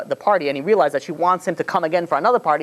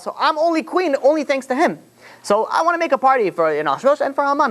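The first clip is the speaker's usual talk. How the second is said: Esther's lines pitched up high, gushing; high voiced queen; 9 semitones higher than usual. Esther inviting the king, voiced high and eager.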